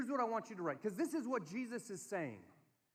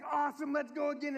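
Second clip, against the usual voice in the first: high, loud cheering; acted over-eager enthusiasm; rally a little kid, imitating a keen dad; excited dad; 8 semitones higher, 6 dB louder than usual.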